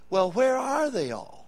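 slow, sneering mock question; mocking critic. Preacher mimicking critics of soul winning, sneering. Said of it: "here's what you say".

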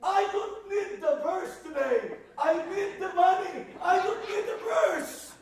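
high, loud, laughing rant; preacher hamming up his angry younger self to the crowd; mock angry shout. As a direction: yell it at mom, laughing at yourself. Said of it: "whispering in myself".

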